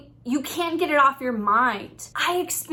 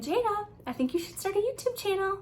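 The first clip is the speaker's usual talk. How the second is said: gentle suggestion; 8 semitones higher, 5 dB quieter, faster than usual. Retold or imitated. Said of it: "spoke to me about a year ago saying".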